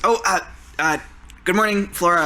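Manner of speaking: put-on high, hesitant, timid young voice; Mark's nervous greeting. Scrawny new counselor greets Flora, high and nervous. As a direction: stammer out a shy good morning. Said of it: "and he says".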